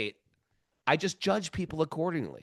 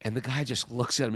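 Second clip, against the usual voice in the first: ordinary delivery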